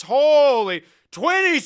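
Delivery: high, loud, slow drawn-out cry; mock exclamation. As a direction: stretch it out in hammed-up shock. Exclaiming about turning twenty.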